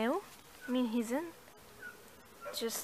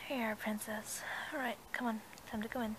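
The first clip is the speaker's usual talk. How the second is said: soft coaxing; 3 semitones lower, 7 dB quieter than usual. Acted. Coaxing horse softly.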